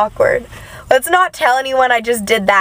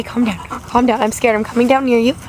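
ordinary talk, nothing marked

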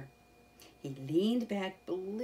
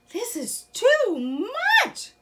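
much higher, louder and slow squeal; 12 dB louder than usual. Acted. Mole sees the picnic feast, loud high squeal. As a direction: squeal with overwhelmed delight. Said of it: "Mole squealed".

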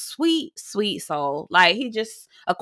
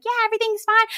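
high mock Larsa; 13 semitones higher, faster than usual. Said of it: "And she's like".

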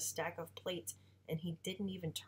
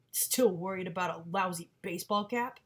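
louder sneering father voice; mocking father; 6 dB louder than usual. Father taunting his son about the cap, sneering. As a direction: belittle the boy with a sneer.

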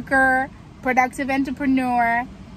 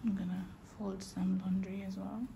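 whispering; 3 semitones lower, 14 dB quieter than usual. Real, not acted.

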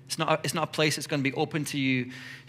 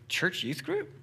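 baffled question; 10 semitones higher, slower than usual. Pretend. Teen friend baffled.

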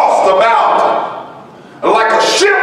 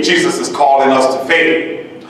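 normal talking voice

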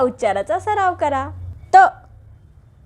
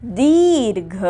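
दीर्घ stretched very slowly; slow drawn teacher word; much slower than usual. Shown as a demonstration. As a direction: let दीर्घ linger long. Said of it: "त".

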